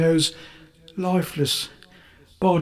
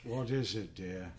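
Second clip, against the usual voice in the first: hushed reply; 5 semitones lower, 19 dB quieter than usual. Acted. Husband feigns innocence, very hushed.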